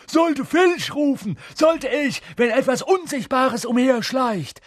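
very high, loud taunting threat; mischievous poltergeist, loud and gloating; threatening poltergeist. A narrator's reading. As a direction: menace them in a piercing high voice. Poltergeist threatens to tell the caretaker.

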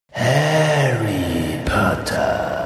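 slow, low, hissing dark lord; Voldemort's voice. A narrator's reading. Voldemort greets Harry from the back of Quirrell's head, slow and menacing. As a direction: speak his name slowly, like a threat from the shadows.

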